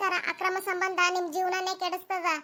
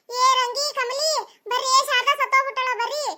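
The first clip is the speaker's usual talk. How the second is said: higher, louder mock-alarmed call; calling out to neighbours; 5 semitones higher, 3 dB louder than usual. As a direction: shout for the neighbours to come running.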